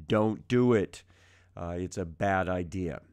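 normal talking voice

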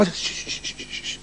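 soft voiceless shushing; soft comforting shh; soothing shush. Acted. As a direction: hush her gently. King hushing Beryl.

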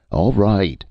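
a contained narrator's shout. A narrator's reading. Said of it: "Fannia shouted".

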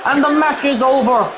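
hyped play-by-play of the match; wrestling commentator. Real, not acted. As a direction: call the action live.

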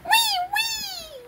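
very high squealed fake sob; mock crying wail, tiny and high; Mickey Mouse crying. Playing the toy mouse after "go", high pretend wail. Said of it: "Mickey Mouse go".

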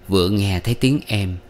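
plain reading voice

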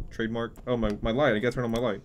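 ordinary talk, nothing marked

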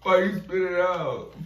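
high laughing question. Real, not acted. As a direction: laugh while asking. Laughing accusation.

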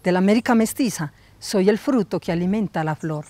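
low, solemn spoken-word intro; poetic recitation. Acted. Introducing herself to her audience, low solemn poem.

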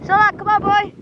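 high call to the dog. Real, not acted. Calling the dog.

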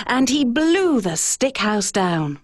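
ordinary delivery, nothing marked

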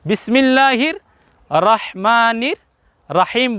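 slow, with open aa in "রহমানির" and "রাহিম"; mistaken open ra. Shown as a demonstration. Teacher pointing out the mistake here, open ra. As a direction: stretch "রহমানির রাহিম" as rahmaanir raheem. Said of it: "এখানে একটি ভুল".

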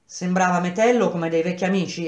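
plain reading voice

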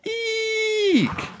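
very high, drawn-out eek; scream. A narrator's reading. Emily pinned by the bear, shrill scream. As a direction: squeal at the top of the range. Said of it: "Emily screamed".

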